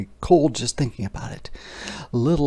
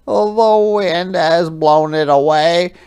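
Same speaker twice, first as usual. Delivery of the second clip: higher, slow, earnest little hedgehog; a small creature deciding bravely; 6 semitones higher, 7 dB louder, slower than usual. Acted. Storyteller voicing Little Hedgehog, higher and slow.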